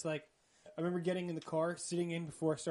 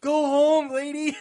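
high, very loud mock outburst; exasperated shout; 10 semitones higher, 15 dB louder than usual. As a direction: yell it at her to send her away. Acted exasperated order to his date.

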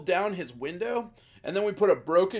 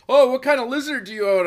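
cheery clerk; 6 semitones higher, 8 dB louder than usual. Retold or imitated. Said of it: "the guy's like".

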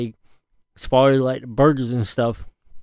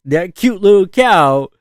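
louder, punchy emphasis on cute little cow; pointed louder emphasis; emphatic louder; 4 semitones higher, 6 dB louder than usual. Acted. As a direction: push it out to make the point. Hammering point home.

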